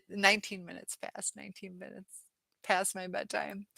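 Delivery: usual speaking voice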